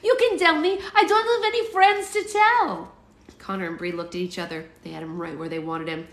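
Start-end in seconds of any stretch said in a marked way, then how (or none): 0.0-2.9 put-on high, loud pleading kid voice; hammed-up desperate little boy; desperate to know. A narrator's reading. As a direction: squeal it, begging to be trusted. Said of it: "desperate to know".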